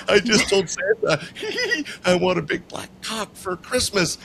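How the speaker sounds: high, breathless giggling between the words; a tipsy waitress bursting with laughter telling her friends. Retold or imitated. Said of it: "and she goes".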